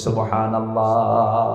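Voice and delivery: slow, drawn-out Subhanallah; loud praise call. Real, not acted. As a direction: lead the crowd, stretching the last syllable. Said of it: "জোরে বলি". (টেনে জোরে সুবহানাল্লাহ)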